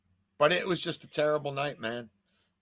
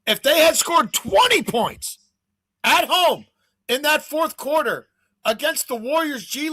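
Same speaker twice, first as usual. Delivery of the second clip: louder and higher, slowed, hammering each point; exasperated rant; 8 semitones higher, 7 dB louder than usual. Real, not acted. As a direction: vent about the game that should have paid out. Ranting about a bad beat.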